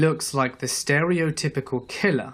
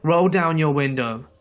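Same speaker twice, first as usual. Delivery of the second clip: raised, higher-pitched retold yell; 3 semitones higher, 4 dB louder than usual. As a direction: call out firmly through a closed car window. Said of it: "he starts yelling".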